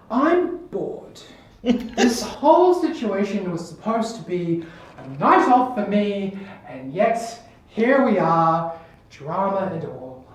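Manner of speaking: languid grande dame, stretching the words; bored, haughty Countess. Acted. The Countess complains to the four intruders, theatrically bored. Game master plays Countess.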